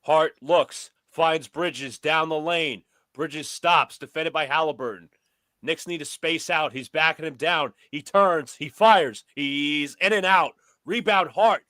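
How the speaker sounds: brisk sportscaster calling the action; play-by-play commentary. Pretend. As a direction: call it like a courtside announcer. Plays announcer over the game.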